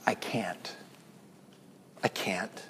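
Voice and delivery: breathless secret admission; hushed whisper of I can't; whispered confession. Shown as a demonstration. Praying admission to God.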